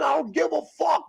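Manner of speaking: high-pitched shouted retort, reenacted; defiant yell. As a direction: yell back in retold defiance. Retold yelled reply to father.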